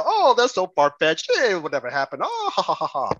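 mimicking critics who laugh the idea off; mocking laughter. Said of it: "some folks would laugh at it and say".

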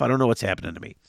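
stuffy blocked nose; talking through a stuffed-up nose; congested. Real, not acted. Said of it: "Suddenly I'm all congested".

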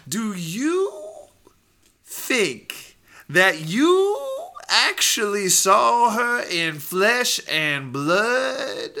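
very slow, high, loud disbelief; courtroom prosecutor. Acted. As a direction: draw out the question theatrically. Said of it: "[name] is gonna be the prosecutor".

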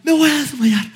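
high-pitched imitated panicked wail; child in shock. Son to angry dad, imitated panic in shock. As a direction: act out a boy overwhelmed by panic. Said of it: "no, no jugando".

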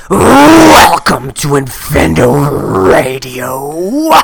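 slow, very loud drawn-out growl; Macho Man voice. Acted. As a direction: announce it like a wrestler. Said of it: "I'll give you one though".

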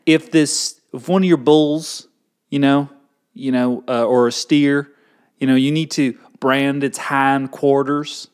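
folksy Southern rancher voice; Southern accent. Pretend. Explaining the rule through cattle branding, Southern accent. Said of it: "do it in a Southern accent".

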